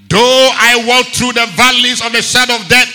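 loud, fervent sermon delivery; preaching at full force. Real, not acted. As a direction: drive the psalm home to the church.

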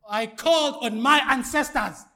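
high, very loud scripture quote; booming mock misreading. Shown as a demonstration. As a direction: declaim the false verse to test the church. Second deliberate misreading to church.

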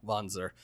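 Wanzer with an open "ah", like "VAHN-zer"; low, soft Wanzer with a broad "ah"; Eastern European pronunciation. Shown as a demonstration. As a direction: say Wanzer with the vowel of "father". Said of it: "it should be more".

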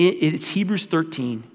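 ordinary talk, nothing marked

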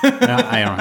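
high, giggles breaking through; laughing at own bad joke. Real, not acted. Host giving up on his cheeks pun, laughing.

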